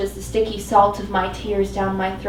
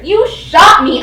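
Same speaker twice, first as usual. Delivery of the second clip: higher, louder and slower, measured; guarded; 8 semitones higher, 11 dB louder, slower than usual. Acted. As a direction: state the obvious warily. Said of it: "my voice guarded".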